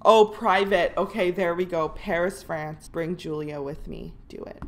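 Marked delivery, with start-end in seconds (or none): none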